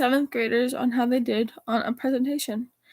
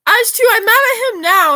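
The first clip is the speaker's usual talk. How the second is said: loud, high, indignant declaration; mad outburst; 9 semitones higher, 12 dB louder than usual. Real, not acted. Venting to viewers about him, angry loud outburst. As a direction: announce it with real annoyance.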